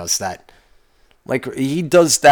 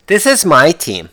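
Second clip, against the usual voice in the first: mocking fans; 5 semitones higher, 4 dB louder than usual. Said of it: "People calling them my teams".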